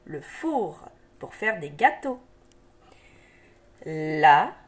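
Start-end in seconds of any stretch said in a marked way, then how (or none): 3.9-4.6 "La mouche" slow, as la - mou - che; syllable by syllable. Shown as a demonstration. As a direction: stretch and separate each sound of "La mouche". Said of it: "des syllabes qui se donnent la main".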